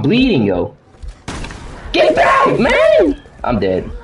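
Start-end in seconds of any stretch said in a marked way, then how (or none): 1.9-3.2 yelling get back in panic; panicked shout. Real, not acted. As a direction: scream it, terrified. Panic in horror game.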